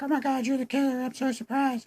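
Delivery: squeaky high falsetto, hammed up; mock surprised woman. Reviewer mocks Emma facing the revealed killer, falsetto fake surprise. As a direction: parody a girl's shock in thin falsetto. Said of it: "And she's like".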